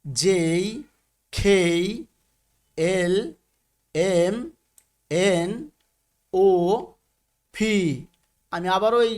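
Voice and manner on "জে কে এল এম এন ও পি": letters one by one, long pauses between; measured model reading of the alphabet; alphabet recitation. Shown as a demonstration. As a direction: recite each letter name clearly, leaving room to repeat. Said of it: "অর্থাৎ আমরা পড়ব".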